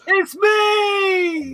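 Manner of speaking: loud high squeal of glee; excited shout. Pretend. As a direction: shout it out triumphantly. Jokingly claims to be first in chat.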